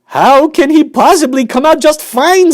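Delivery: very high, loud angry retort; a furious mother snapping at the factory owner. Acted. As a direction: snap back at him. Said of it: "snapped Missus Gloop".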